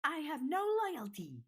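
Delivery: gleeful taunt from a tiny beast; Snowdrop shouting. Acted. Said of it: "Snowdrop shouted".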